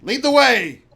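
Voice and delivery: loud, high order; commanding captain. Acted. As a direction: call out a command. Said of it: "Says, uh".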